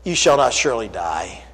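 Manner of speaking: understated sly serpent. Acted. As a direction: let the serpent murmur it low. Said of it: "the serpent said unto the woman".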